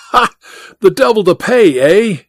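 mildly excited, rushing in with gossip; excited cry. Acted. Goodall bursting in from the street, mildly excited. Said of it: "he cried excitedly".

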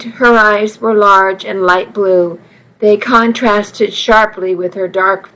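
ordinary delivery, nothing marked

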